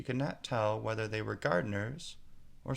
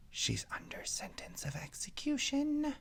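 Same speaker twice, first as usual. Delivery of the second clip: much higher, breathless rabbit whisper; 3 dB quieter than usual. A narrator's reading. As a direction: lean to her ear and whisper. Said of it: "and whispered".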